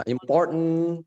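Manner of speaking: slow "important", t stopped before a syllabic n; held T. Shown as a demonstration. Teacher modelling for students, slow "important" with held t. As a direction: hold the t in "important", release only as n. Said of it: "langsung ditahan".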